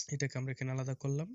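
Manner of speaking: ordinary talk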